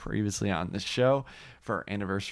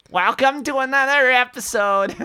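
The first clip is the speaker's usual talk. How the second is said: high, loud squeaky host voice; 8 dB louder than usual. Pretend. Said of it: "you're like".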